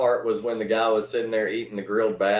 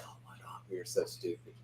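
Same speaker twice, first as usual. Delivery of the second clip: whisper; 4 semitones lower, 20 dB quieter than usual. Retold or imitated. Retold reaction at school.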